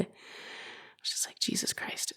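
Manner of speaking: breathless stunned whisper; hushed disbelieving whisper; whispered Jesus Christ. Retold or imitated. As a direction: whisper it under your breath in shock.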